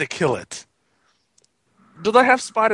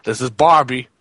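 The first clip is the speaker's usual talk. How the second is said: put-on American voice; 7 semitones lower than usual. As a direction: play a deep-voiced American caricature. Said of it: "I'm American now".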